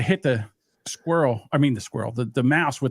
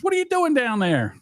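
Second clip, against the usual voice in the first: dad calling down; 10 semitones higher than usual. Retold or imitated. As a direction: quote dad calling down, pitched way up. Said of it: "He says".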